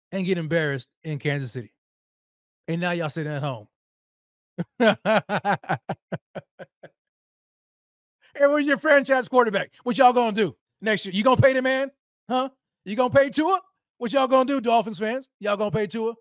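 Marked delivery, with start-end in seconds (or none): 4.6-6.9 gloating laugh. Real, not acted. Gloating over Dolphins' loss. 8.4-16.1 loud taunting. Real, not acted. Taunting Dolphins fans.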